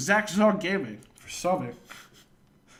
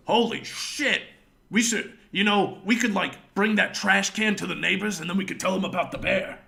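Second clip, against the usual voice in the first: louder, higher excited quoting; mock excitement; 5 semitones higher, 5 dB louder than usual. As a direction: imitate a friend with a big idea. Retold drunk friend's excited idea.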